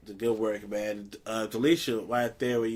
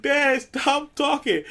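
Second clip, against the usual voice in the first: mock embarrassed son; 12 semitones higher, 4 dB louder, slower than usual. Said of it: "he was like".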